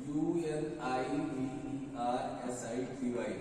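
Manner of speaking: low, soft, slow letter-by-letter spelling. Shown as a demonstration. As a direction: spell it slowly under your breath. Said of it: "university का और union का जैसे देख लो".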